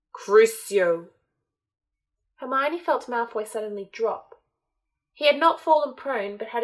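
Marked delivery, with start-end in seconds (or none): 0.1-1.1 loud, sharp incantation; shouted curse. Acted. Voldemort shouting the curse at Malfoy, loud and harsh. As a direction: hurl the spell at him.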